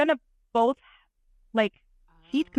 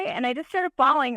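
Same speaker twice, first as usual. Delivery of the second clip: usual speaking voice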